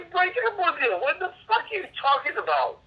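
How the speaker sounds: high-pitched, incredulous protest; real exasperated outburst; outraged denial. Real, not acted. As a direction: protest hotly, voice shooting up. Heated denial of breaking up.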